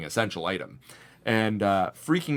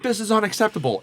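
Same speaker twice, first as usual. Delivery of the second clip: high, louder mock indignation; retold customer outburst; 11 semitones higher, 5 dB louder, faster than usual. Customer lashing out at the store worker, retold outburst. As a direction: imitate an outraged customer, pitched up. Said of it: "just starts freaking out".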